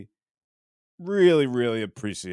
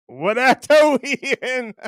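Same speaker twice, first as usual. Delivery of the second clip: laughing; 11 semitones higher, 7 dB louder than usual. Real, not acted. Reading a viewer's joke aloud.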